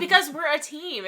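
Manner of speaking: loud, high exasperated retort; exasperated outburst. Acted. Partner insisting they are a team, loud and exasperated. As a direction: hammer the point home.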